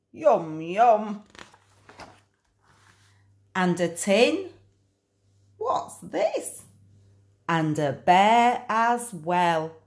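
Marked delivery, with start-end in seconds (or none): 0.1-1.2 dog's voice. Acted. Dog finds bone. 5.6-6.6 very high, soft wondering question; puzzled little high voice; high curious question; curious surprise. Acted. Dog finds bear.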